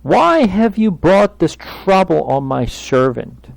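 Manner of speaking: loud, high, slow protest to God; aggrieved complaint. Acted. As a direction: cry out a complaint, drawn out. Acts out Moses complaining to God.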